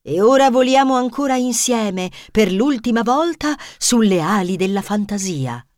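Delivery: calm wise fox; enigmatic fox. Acted. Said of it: "Volpe aggiunse enigmatica".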